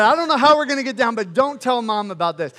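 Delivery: loud, hurried retold outburst; urgent plea. Dad to his son on the cliff, urgent plea. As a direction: blurt it out fast and high, then press the point.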